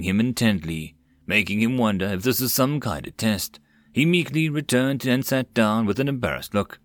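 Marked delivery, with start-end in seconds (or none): none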